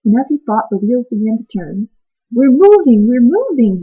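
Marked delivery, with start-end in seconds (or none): 2.3-3.8 louder, higher joyful cry; a boy's excited shout; happy shout. Acted. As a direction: shout with delight. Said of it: "he shouted happily".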